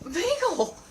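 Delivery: laughing dog scold. Real, not acted. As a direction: tell the dog off through a laugh.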